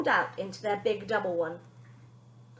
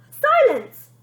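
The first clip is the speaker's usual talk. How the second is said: much higher, louder one-word command; barked order; 14 semitones higher, 12 dB louder than usual. Acted. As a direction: silence them at full force. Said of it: "Bonnie ordered".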